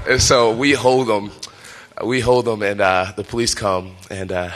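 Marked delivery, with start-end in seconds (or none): none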